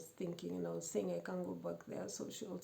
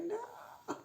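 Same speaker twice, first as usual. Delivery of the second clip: very high frightened no; a shrill cry of refusal; high desperate no; panicked no. Retold or imitated. Panic peak.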